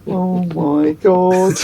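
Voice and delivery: slow, high, laughing gasp; laughing disbelief. Retold or imitated. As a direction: laugh out the shock. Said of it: "realising it's really [name]".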